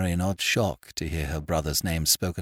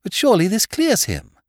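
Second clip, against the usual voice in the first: high, loud protest; urgent, high-pitched woman's exclamation; crying out; 10 dB louder than usual. A narrator's reading. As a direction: plead his case in a raised voice. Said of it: "cried Mary".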